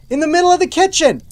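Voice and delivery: high, loud burst of disbelief; comic outburst of exasperation; exasperated shout. Real, not acted. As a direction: punch it out, loud and incredulous. Exasperated rant at ignored pudding.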